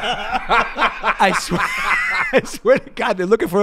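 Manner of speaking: I swear half-laughing, voice lifted high and loud; laughing insistence. Real, not acted. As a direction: push the oath out through real laughter. Laughing at cockpit chaos.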